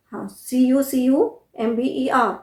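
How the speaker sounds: usual speaking voice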